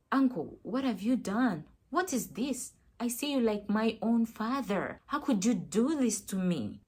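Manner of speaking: loud, outraged and hurt; shocked confrontation. Retold or imitated. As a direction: confront the uncle loudly in shock and betrayal. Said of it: "I got so shocked".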